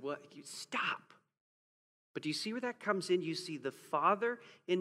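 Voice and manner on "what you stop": soft, whispered scolding after crying; tearful mom. Retold or imitated. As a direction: hush them through tears. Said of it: "wept before she looked at us and said".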